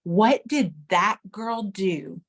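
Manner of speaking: normal talking voice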